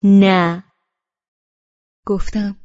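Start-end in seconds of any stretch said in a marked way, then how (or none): 0.0-0.6 a loud, stretched, determined no; husband refuses flatly, drawn out and loud; firm drawn-out no. A narrator's reading. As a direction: plant the word and hold it. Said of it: "مصمم و غلیظ و کشیده گفت".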